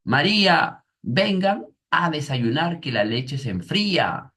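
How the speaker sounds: llamada alta y aguda de mamá; llama a los niños a desayunar a gritos; mamá gritando. Retold or imitated. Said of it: "grita, ¿no?". (la mamá llama a sus hijos a desayunar, gritando)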